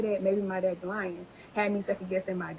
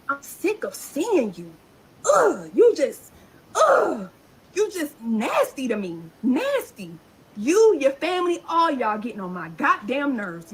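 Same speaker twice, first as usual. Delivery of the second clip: furious; 2 semitones higher, 5 dB louder than usual. Real, not acted. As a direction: let the anger boil over at them. Venting at her harasser.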